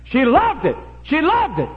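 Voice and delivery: high, loud preacher's cry; emphatic shout. Real, not acted. Preacher on a wife's love of revelry, emphatic shout. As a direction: cry it out at full preaching force. Preacher drives the point home.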